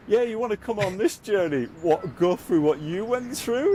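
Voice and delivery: incredulous onlookers. Retold or imitated. Mock voice of doubtful onlookers.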